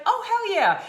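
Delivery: high, loud enthusiastic yes; hammed-up cheer of approval; excited friend. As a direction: burst out with glee. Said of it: "she's like".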